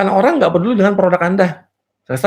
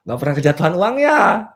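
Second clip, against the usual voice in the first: ordinary talk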